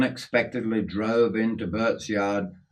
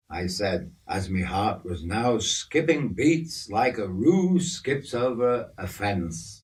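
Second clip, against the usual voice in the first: ordinary delivery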